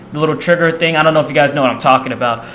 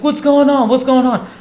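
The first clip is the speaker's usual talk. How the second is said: lightly voiced worried mother, higher; worried mom; 9 semitones higher than usual. Mom running downstairs asking her son what happened. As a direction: ask fast and alarmed, as mom would. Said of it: "She's like".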